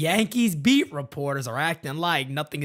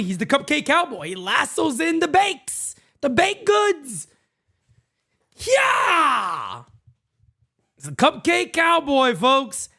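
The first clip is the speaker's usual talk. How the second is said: much higher, loud showman call; hyped announcer; 11 semitones higher, 4 dB louder than usual. Acted. Host introducing the Cupcake Cowboy to chat, hyped. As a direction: sell it like a rodeo announcer.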